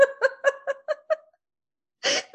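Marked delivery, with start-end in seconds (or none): 0.0-0.0 laughing. Real, not acted. Laughing about getting stuck.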